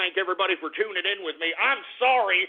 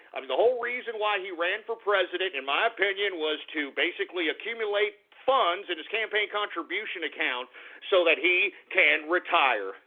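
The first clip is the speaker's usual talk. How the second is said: usual speaking voice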